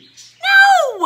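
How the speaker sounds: very high, loud laughing "No"; shrieking laughing refusal; laughing shout. Real, not acted. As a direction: burst out in shocked laughter. Reacting to shocking request.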